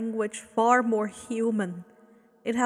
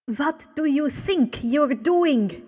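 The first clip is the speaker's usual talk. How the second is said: a sharp, raised snap; snapped; 4 semitones higher, 5 dB louder than usual. Acted. Abel snaps at the two spirits, raised and sharp. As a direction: cut them off in anger. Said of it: "Abel snapped".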